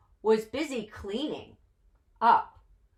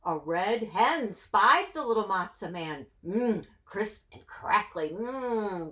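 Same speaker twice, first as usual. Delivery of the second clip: ordinary delivery, nothing marked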